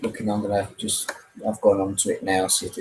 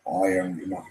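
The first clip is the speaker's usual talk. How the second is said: a lightly lowered Vader line; a hint of the Sith lord; Darth Vader; 5 semitones lower, 8 dB quieter than usual. Streamer tries Vader for a fan. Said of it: "Darth Vader".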